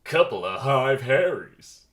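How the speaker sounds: slow, loud put-on jock voice; pollen jock. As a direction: stretch it out with mocking swagger. Pollen jock mocks the two bees.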